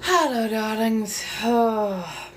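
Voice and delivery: slow, drawn-out glamour greeting; breathy vintage purr. Pretend. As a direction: purr it like an old film star. Greeting viewers in a put-on voice.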